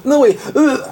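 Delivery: high laughing retch; hammed-up gagging; laughing gag. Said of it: "empezó". (arcada entre risas)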